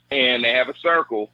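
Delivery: usual speaking voice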